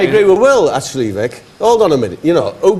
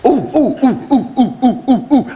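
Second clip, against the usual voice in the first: hooting; 4 semitones higher than usual. Acted. Hooting at the question.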